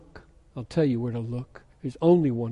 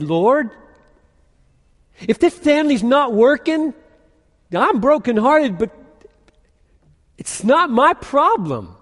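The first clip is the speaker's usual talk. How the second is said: much higher and louder, indignant self-justifying plea; self-righteous dad; 9 semitones higher, 7 dB louder than usual. Retold or imitated. A self-righteous father protesting to the Lord, loud and indignant. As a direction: protest your innocence to God, loud and wounded. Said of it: "I'm gonna say to the Lord".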